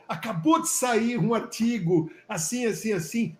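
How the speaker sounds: lightly acted eager excitement; excited retelling. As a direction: imitate someone all worked up over news. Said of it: "Ele ficou todo acelerado e falou".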